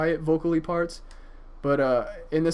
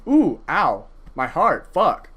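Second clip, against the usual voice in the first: overwhelmed; 2 semitones higher, 6 dB louder, slower than usual. Real, not acted. Moved by the song.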